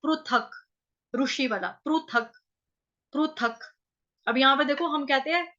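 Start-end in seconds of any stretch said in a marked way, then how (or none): 0.0-3.6 पृथक said प्रुथक with ru; pruthak. Shown as a demonstration. Teacher shows Sanskrit pru sound in पृथक. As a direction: say पृथक as प्रुथक, not प्रिथक. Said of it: "Sanskrit में इसको प्रु कहते हैं".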